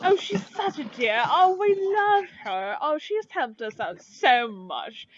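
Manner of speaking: high, adoring fawning voice; gushing shopkeepers. Acted. Shopkeepers fawn over absent friend.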